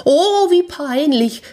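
high, drawn-out wail of embarrassment; hammed-up mortification; mortified cry. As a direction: cry out in acted shame. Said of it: "rief sie".

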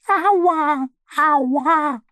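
hohe jammernde Entenstimme, viel höher; jammernd. A narrator's reading. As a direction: Aua kläglich herausjammern. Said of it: "jammert sie". (Ente jammert hoch nach dem Sturz)

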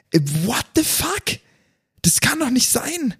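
high, disbelieving outburst; can't believe it, voice jumping up; exasperated disbelief. Real, not acted. Rant about broken update.

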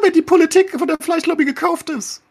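real frustration in an argument; heated exasperation. Real, not acted. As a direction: press him hard, fully exasperated. Heated debate about meat lobby.

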